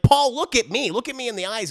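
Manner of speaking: hammed-up demand for eye contact; mock exasperated scolding. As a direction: scold him in pretend frustration. Mock scolding of downcast Paul.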